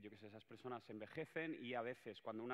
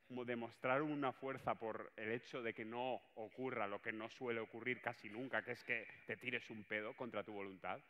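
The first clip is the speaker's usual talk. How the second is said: ordinary talk, nothing marked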